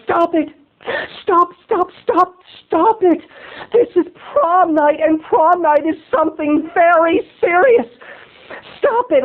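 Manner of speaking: high, whimpering mock plea to quit; whiny high pleading. Dates begging the pranksters to stop, whiny high plea. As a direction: whine at them like a scolded prude. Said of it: "Richard and Leah were like".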